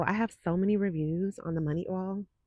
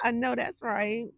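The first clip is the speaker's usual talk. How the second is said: usual speaking voice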